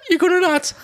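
laughing squeaky cartoon voice; giggling high-pitched squeak. Mickey voice amid laughter, very high.

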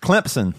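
Clemson said as "Clempson". Shown as a demonstration. Showing how Southerners say it, Clemson with a P. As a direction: put a P into Clemson: "Clemp-son". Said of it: "It's Clemson with a P".

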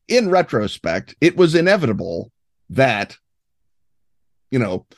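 ordinary talk

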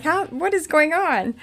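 high, loud disbelieving question; bewildered outburst. Retold or imitated. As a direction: blurt it out in mock bewilderment. Reenacting bewilderment at the accusation.

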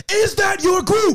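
high, slow sergeant's shout, imitated; drill sergeant. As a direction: bellow the question like a drill sergeant. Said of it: "drill sergeant looking dude up front".

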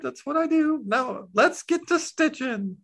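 high, squeaky cartoon object voice; high character voice, slowing at the end; high-pitched talking needle. Plays a figure, Needle.

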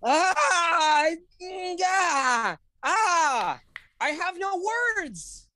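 high, drawn-out wail of adoration; overwhelmed wail. Acted. Podcast guest asked for an opinion on Paddington, overwhelmed wail. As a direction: draw it out high, as if words fail you.

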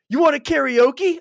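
high, loud, eager offer; hyped-up driver imitation. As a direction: shout it like an over-excited party host.

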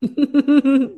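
real amused laugh. Real, not acted. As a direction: laugh along with the guest. Host laughs at earrings line.

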